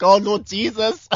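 high startled "Oh, Lord Jesus"; mock panic at a stranger running up; startled cry. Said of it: "I was like".

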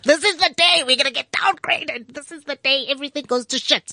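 high, dramatic doomsayer; mock doom voice. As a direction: wail it like the world ends. Mocking doom-laden news talk.

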